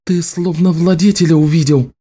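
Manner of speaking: voiced female character; Nicci's voice.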